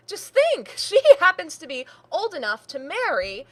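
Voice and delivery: high, laughing sarcastic gush; mocking mimicry. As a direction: throw their excitement back at them, laughing. Niece mocks uncle's matchmaking.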